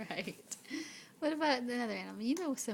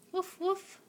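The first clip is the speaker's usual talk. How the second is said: playful dog bark; 7 semitones higher, 4 dB louder than usual. Pretend. Dad imitates dog bark.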